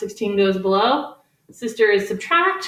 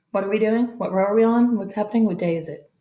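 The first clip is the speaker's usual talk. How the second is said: mock confused. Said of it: "I'm just kidding".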